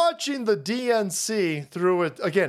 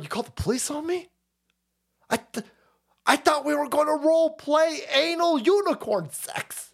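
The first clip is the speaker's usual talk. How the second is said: higher, hurt and fast at first; mock attacker voice; 7 semitones higher than usual. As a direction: act the offended intruder, pitched up. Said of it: "the dude was like".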